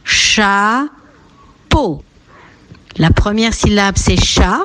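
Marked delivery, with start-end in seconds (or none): 0.0-0.9 CHAPEAU as two separate beats, cha, peau; split into syllables. Shown as a demonstration. Teacher counts syllables for children, Chapeau split in two. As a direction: break Chapeau slowly into two drawn-out syllables. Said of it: "deux syllabes".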